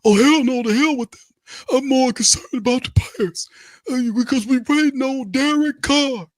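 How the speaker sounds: squeaky high falsetto coach at a press conference; mock the coach, high and whiny; a press-conference parody of the head coach; Coach Rizzi impression. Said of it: "Coach Rizzi".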